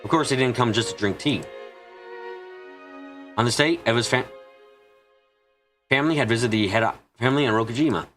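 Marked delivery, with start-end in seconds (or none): none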